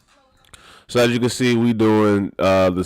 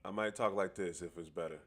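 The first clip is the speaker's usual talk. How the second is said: much softer mic-check voice; toned-down talk; 3 semitones lower, 17 dB quieter than usual. Shown as a demonstration. Testing the mic so as not to sound muffled, toned-down talk. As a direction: keep the volume well down, a little low. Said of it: "'cause I talk loud".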